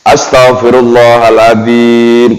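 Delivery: drawn-out, unhurried chant of astagfirullahaladzim; slow devotional recitation. Shown as a demonstration. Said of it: "Pelan-pelan boleh".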